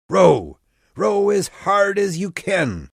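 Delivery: loud, high, urgent rowing command; shouted order. A narrator's reading. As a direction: call it out forcefully to a whole crew. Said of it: "Jason gave the order".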